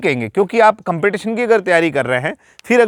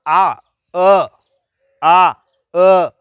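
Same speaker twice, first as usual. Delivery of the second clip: अ and आ drawn out slowly and louder; slow vowel demo; 4 dB louder, much slower than usual. Shown as a demonstration. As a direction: model each vowel slowly from the throat. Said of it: "यहाँ से आवाज़ आ रही है". (धीरे-धीरे खींचकर स्वर का अभ्यास)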